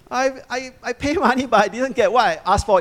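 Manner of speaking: indignant, high complaining king; upset Balak. Acted. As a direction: voice an annoyed king who got cheated. Balak's complaint voiced by preacher.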